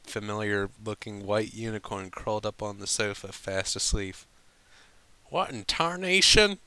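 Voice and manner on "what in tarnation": high, loud astonished cry; shocked farm-pony exclamation; bewildered Applejack. Acted.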